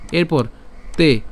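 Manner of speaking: usual speaking voice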